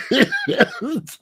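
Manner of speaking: shrill-pitched real laugh; high burst of laughter. Real, not acted.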